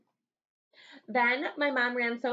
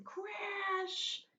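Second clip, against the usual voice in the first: dramatic crash; 10 semitones higher, 5 dB quieter than usual. Acted. Sound word in story.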